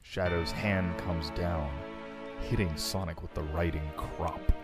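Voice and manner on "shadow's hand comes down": ordinary delivery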